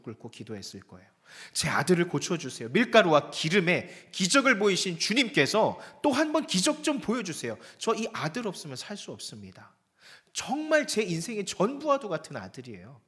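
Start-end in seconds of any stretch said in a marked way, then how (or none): 1.5-13.0 desperate plea. Acted. Preacher voices widow's desperate prayer.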